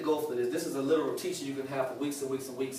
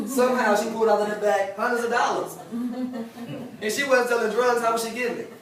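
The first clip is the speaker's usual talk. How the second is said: laughing; 6 semitones higher, 4 dB louder than usual. Real, not acted. Amused story about grandmother's money.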